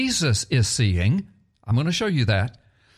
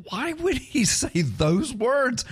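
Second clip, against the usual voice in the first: high, slow and baffled, breaking into a laugh; puzzled listener; 9 semitones higher, slower than usual. Retold or imitated. Pastor voicing a puzzled radio listener, high incredulous laugh. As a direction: wonder aloud why he'd say that, chuckling. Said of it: "and you're thinking".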